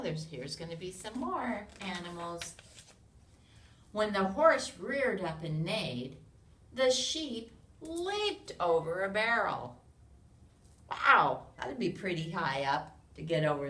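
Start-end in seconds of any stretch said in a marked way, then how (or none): 3.9-9.8 loud, lively storybook read-aloud; animated picture-book narration; reading to children. A narrator's reading. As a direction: read it big for a room of little kids.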